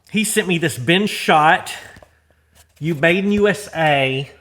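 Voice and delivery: ordinary talk, nothing marked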